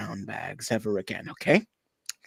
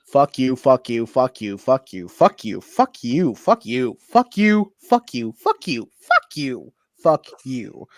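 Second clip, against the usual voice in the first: loud, furious repeated fuck you; angry shouting; 9 dB louder than usual. Acted. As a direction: hurl it at him in a rage. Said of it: "I'm so angry".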